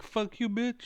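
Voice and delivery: a mock taunt, pitched high; mock trash talk. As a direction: fling the insult up high like old trash talk. Retells his old trash talk.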